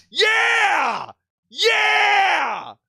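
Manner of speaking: long, high "yeah" shouts; triumphant yell. Real, not acted. Fan celebrates getting his guy, triumphant yell. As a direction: yell it out and stretch it.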